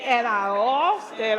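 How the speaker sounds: ordinary talk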